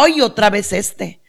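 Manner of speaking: normal talking voice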